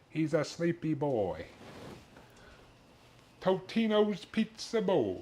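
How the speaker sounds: slow high cooing; cooing at the dog. Acted. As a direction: dote on the sleepy dog. Cooing over sleepy dog.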